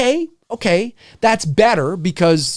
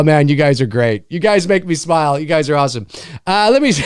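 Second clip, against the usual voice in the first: ordinary delivery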